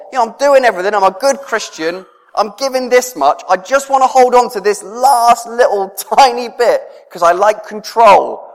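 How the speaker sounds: a lightly mocking bargaining tone; voicing Rach. Rach's thoughts voiced in story.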